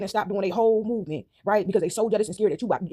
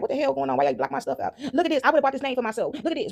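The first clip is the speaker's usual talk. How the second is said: higher, indignant complaint; mock outrage; 6 semitones higher than usual. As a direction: act out the fuming victim. Voicing the outraged victim.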